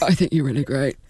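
put-on strong Kiwi accent; New Zealand accent. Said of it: "A really strong New Zealand accent comes through".

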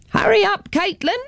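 high, carrying call up the hill. A narrator's reading. Grandma calls Caitlin from halfway up the hill, carrying call. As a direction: call her to hurry from far ahead. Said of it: "Grandma called".

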